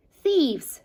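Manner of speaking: high, loud accusing cry; shrieking rat. Acted. Rat shrieking after the foxes running off, loud and outraged. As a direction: yell it after the fleeing thieves. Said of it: "shrieked Rat".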